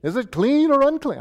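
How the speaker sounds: put-on high-pitched New York accent, playful questioner. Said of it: "give them a New York accent".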